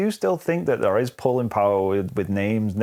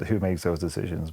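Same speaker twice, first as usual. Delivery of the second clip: usual speaking voice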